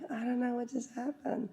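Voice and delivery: soft, dazed little mock voice; ditzy, dizzy. She comes out after the treatment, acting ditzy and dizzy. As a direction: go faint and woozy. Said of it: "a kind of a ditzy, dizzy".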